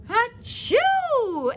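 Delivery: loud, high drawn-out bear sneeze; big sneeze. Acted. The pepper makes the sleeping bear sneeze, loud. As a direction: let the sneeze burst out. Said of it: "makes the bear hurrah".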